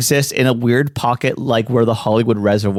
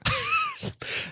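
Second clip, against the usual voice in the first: sudden shrieking laugh; high squeal laugh; 12 dB quieter than usual. Real, not acted. Wild laugh after joke.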